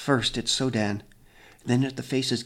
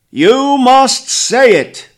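much higher, very loud, slow roar; thundering command; 13 semitones higher, 16 dB louder, much slower than usual. A narrator's reading. Grandfather demanding a confession, thunderous roar. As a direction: crash it out like thunder. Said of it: "a crashing of thunder".

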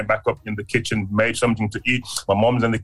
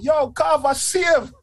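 slow shout, much higher; excited shout; 13 semitones higher, slower than usual. Retold or imitated. Telling the neighbourhood he got saved, a slow high shout. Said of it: "I rolled".

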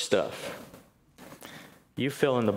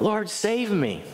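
higher, slow plea, lightly acted; mild cry for help; 9 semitones higher, slower than usual. Peter sinking in the waves, mild slow cry for help. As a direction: call out as if sinking, but understated.

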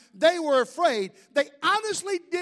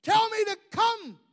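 high, drawn-out call over distance; raised-pitch shout in Peter's role, not full volume; acted yell back; 8 semitones higher than usual. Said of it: "Peter yells back at him".

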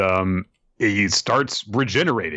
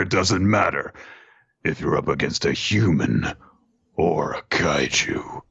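low, slow kaiju voice; low, deliberate monster, sinking to a whisper at the end; battle-hungry monster; 3 semitones lower than usual. Acted. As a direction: relish every word of the fight. Voices kaiju claiming kinship.

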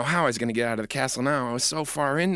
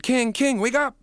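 calling out; 9 semitones higher, 5 dB louder, slower than usual. Acted. Boy calling sleeping king.

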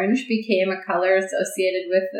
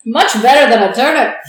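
loud, hammed-up Irish brogue; mock Irish accent; 3 semitones higher, 8 dB louder than usual. Podcaster playing an Irish carver delighted with pumpkins, loud mock Irish. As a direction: belt it out in fake Irish. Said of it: "sorry to all Irish people".

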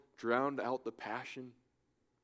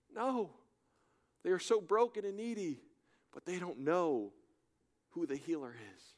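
loud, high, fervent preaching; preaching at full force; 9 semitones higher, 5 dB louder than usual. Acted. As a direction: preach it out to the whole hall, much higher and louder.

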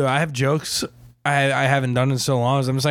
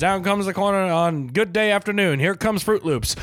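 higher-pitched mock horse-race commentary; race announcer; 4 semitones higher than usual. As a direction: call the runners like a track announcer.

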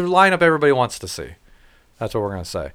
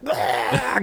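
mock excited yell; 10 semitones higher, 5 dB quieter than usual. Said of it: "going crazy".

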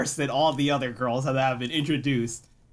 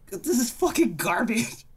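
high, fed-up outburst; disgusted outburst; 9 semitones higher than usual. Retold or imitated. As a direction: spit out the verdict. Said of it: "but, you know, like".

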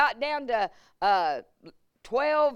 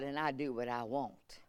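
much softer, falling off mic; fading away; 8 semitones lower, 13 dB quieter than usual. Real, not acted. Pastor handing over, voice fading off the mic. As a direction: let it drop away to almost nothing.

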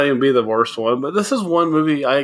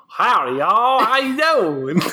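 hammed-up Southern howdy; James impression; 8 semitones higher, slower than usual. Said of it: "my James impression is like".

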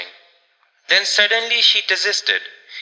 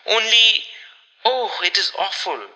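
horrified; 4 semitones higher than usual. A narrator's reading. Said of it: "horror-stricken tone".

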